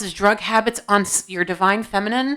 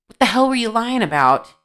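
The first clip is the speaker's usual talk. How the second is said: indignant demand; 3 semitones higher, 6 dB louder than usual. Real, not acted. Reader confronts the man who admitted lying, indignant. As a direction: demand an answer, voice jumping up and louder. Scolding him for the lie.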